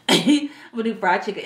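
laughing. Real, not acted. Laughing at her own cooking boast.

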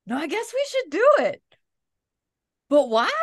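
high-pitched imitation of a conductor's Japanese accent. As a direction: keep up the thick Japanese accent, puzzled. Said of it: "he had a very thick Japanese accent".

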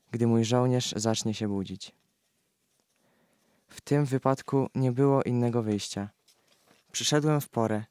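normal talking voice

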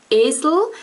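esel with a closed first e and a schwa; Swiss German esel. Shown as a demonstration. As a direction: esel demonstrated for learners. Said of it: "That has this one".

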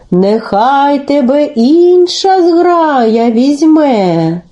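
very slow, drawn-out goose voice; refusing geese. Acted. Geese turn Telesyk down, slow drawn-out refusal. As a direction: stretch every vowel like a passing flock.

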